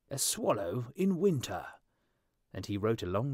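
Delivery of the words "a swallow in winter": pompous professor. A narrator's reading.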